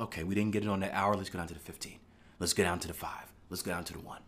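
softly voiced trader chasing time frames; hushed inner voice of a chasing trader; quiet self-talk. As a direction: murmur each step down to yourself.

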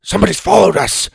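high, loud and fast alarm; alarmed dragon man. Acted. Dragon man warning the hunting group, loud startled alarm. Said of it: "said one of the dragon men".

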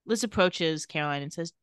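usual speaking voice

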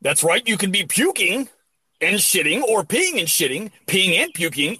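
a hint of a smooth sports announcer; host the product segment like a pro commentator; legit commentator. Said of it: "You sound like a legit commentator".

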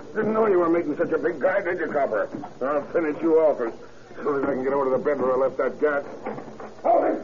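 ordinary talk

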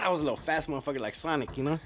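ordinary talk

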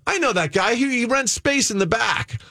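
high, lively quoted reply; eager recognition. Retold or imitated. Business owner confirming he knows the guy, imitated eager recognition. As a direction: imitate someone brightly recalling a familiar name.

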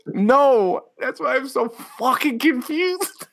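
high-pitched wheezing laugh over every word; hysterical laughter. Real, not acted. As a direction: barely get the words out for laughing. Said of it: "how fucking hard you're laughing right now".

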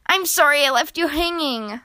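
ordinary delivery, nothing marked

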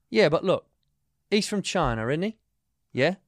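imitated English driver's accent; chatty Englishman impression, British accent. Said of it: "the accent you just did".

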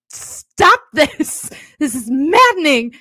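loud, high hammed-up protest; mock sobbing plea. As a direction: plead as if bawling on the treadmill. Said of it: "I'm sobbing. I'm like".

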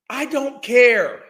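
slow, loud, spaced out; I don't care. Real, not acted. Ranting at fat acceptance listeners, slow and loud. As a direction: lean into every word.

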